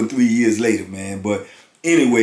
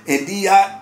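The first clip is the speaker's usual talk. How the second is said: usual speaking voice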